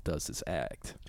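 breathy whispered aside; whispering. Acted. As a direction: whisper it like a secret. Reading slang definition aloud.

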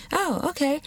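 high, light imitated acceptance; mock understanding. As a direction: play someone sweetly letting it go. Mimics reaction to an introvert.

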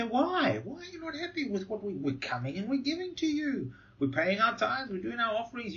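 high whiny protest of the self-righteous; mock indignant protest. Preacher mimicking the people's indignant protest to God.

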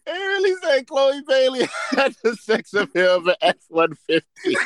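can barely get it out for laughing. Real, not acted. S0 cracking up retelling a post to friends, laughing. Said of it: "laughing retelling".

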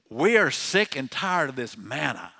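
loud, fed-up mock complaint; complaining. Israelites complain to Moses about manna, complaining. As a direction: imitate a crowd sick of its food. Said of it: "they're complaining about the food".

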